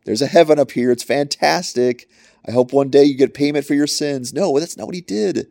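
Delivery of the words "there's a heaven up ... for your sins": louder, higher, breezy call down from heaven; mock shout from heaven. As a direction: call out sweet nothings from the sky, hammed up. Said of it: "if he just shouted from heaven".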